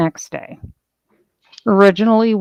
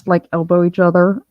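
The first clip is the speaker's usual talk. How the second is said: usual speaking voice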